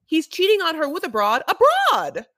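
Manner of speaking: loud, high scandalized proclamation; mock outraged outburst. Revealing the cheating to a friend, mock outraged outburst. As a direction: belt out the juicy reveal.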